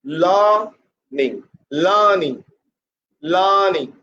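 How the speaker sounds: LEARNING as long LAA-ning, falling off; stress on first syllable. Shown as a demonstration. Teacher drilling pressure on the first syllable, stressed LAA-ning. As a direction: lean hard and long on LEAR in LEARNING. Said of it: "Put pressure on the first syllable".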